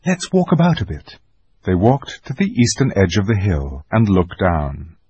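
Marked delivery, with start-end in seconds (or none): 0.0-1.2 put-on higher girl's voice; a girl trying to keep moving; Susan suggesting. A narrator's reading. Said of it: "said Susan".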